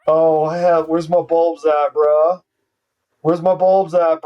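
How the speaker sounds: ordinary talk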